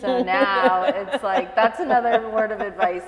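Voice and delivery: giggling while giving advice; laughing advice. Real, not acted. Mom advising the interviewer about free time, laughing.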